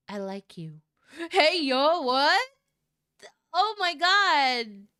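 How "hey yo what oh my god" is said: loud and higher, stunned; shocked yell. Real, not acted. Reader reacts to the confession with a stunned yell. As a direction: shout in disbelief.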